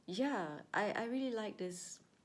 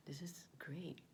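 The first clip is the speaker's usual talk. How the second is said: whispering; 9 dB quieter than usual. Real, not acted. Whispered savouring while eating.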